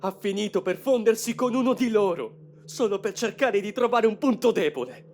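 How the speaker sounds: impassioned. Acted. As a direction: push it out with burning conviction. Son tells of father's sacrifice.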